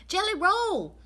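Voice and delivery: high, loud surprised cry; exclaimed. Acted. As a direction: blurt it out in amazement. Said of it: "exclaimed Amelia Bedelia".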